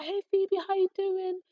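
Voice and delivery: very high, sing-song greeting; head of year. Retold or imitated. Head of year spotting a pupil on the train, high sing-song hello. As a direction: imitate an over-bright teacher's hello.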